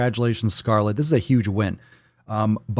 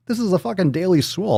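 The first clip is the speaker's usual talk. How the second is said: mock indignant; 9 semitones higher, faster than usual. Real, not acted. Defending farting on his show.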